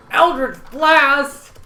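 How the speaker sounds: huge, slow battle cry; spell shout. Acted. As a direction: roar out the spell name. Casting a spell dramatically.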